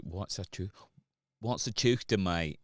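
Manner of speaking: puzzled Englishman impression; mock English accent. English people puzzled by a Scots word, mock English accent. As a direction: put on a baffled English accent. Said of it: "English people are like".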